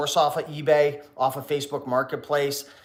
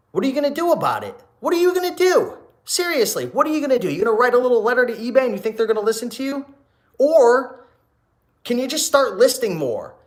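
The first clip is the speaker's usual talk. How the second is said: heated challenge; 9 semitones higher, 5 dB louder than usual. Real, not acted. Challenging a complaining commenter.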